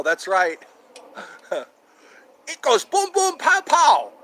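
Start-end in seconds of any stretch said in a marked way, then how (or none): 2.5-4.1 high, loud cartoon voice; hammed-up yell, higher and louder; Laotian neighbor impression. Doing the cartoon guy from Laos, high loud impression. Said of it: "I do that guy from Laos".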